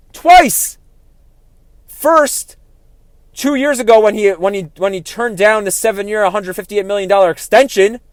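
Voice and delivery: fired up and ranting at the player; heated. Real, not acted. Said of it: "I got very heated in that one".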